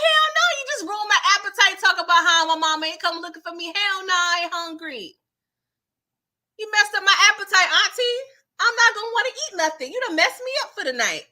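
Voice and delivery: put-on teen ranting at her auntie about losing her appetite; indignant rant. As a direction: rattle it off, fed up. Juke outraged her appetite ruined.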